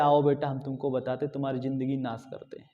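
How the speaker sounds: low, soft beckoning, fading away; mock coaxing. Calling the youngster over to ruin their life, mock coaxing. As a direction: draw them in quietly, voice dropping. Imagined beckoning of an aspirant from afar.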